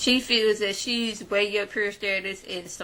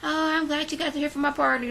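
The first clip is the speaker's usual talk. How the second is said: mock gushing; 7 semitones higher, 4 dB louder, faster than usual. Mocking a castmate's gushing.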